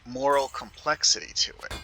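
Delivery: ordinary delivery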